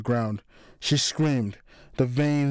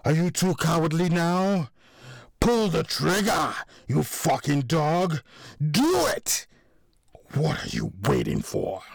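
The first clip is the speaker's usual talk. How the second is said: taunting challenge; 4 semitones higher than usual. A narrator's reading. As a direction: dare them to pull the trigger, heated. Goading someone to shoot, villain scene.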